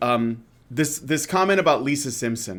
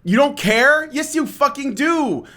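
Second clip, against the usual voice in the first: more incredulous; 10 semitones higher, slower than usual. Real, not acted. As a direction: push the disbelief further, pitch up. Sarcastic repeat at the guest.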